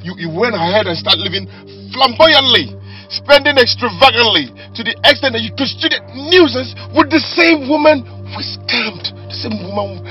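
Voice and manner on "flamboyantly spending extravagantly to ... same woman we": raised, emphatic tirade; angry scolding. Acted. Husband scolding wife over spending, emphatic anger. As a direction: lean angrily on each accusation.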